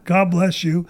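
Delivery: ordinary talk, nothing marked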